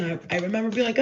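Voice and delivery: ordinary talk, nothing marked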